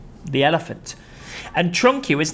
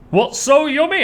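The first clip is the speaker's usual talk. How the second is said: much higher, louder question; puzzled hippo; 12 semitones higher, 5 dB louder than usual. Acted. Humpy Rumpy asking what is yummy. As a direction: pipe up curiously.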